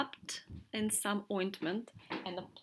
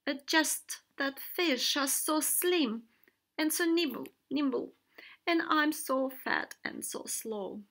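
sad whale; 5 semitones higher, 5 dB louder than usual. A narrator's reading. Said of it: "Whale said sadly".

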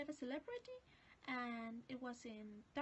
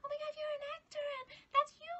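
much higher, excited fangirl shrieks, imitated; mock screaming kids mobbing the actor; screaming schoolkids; 4 dB louder, faster than usual. Forty schoolkids mobbing the actor, high excited mock shrieks. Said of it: "started screaming".

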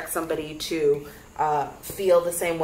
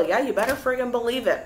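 ordinary talk, nothing marked

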